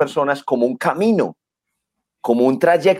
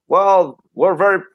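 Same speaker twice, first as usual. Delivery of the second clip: Obama impression; 3 semitones higher than usual. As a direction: lightly do Obama's smooth English. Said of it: "Barack Obama decía".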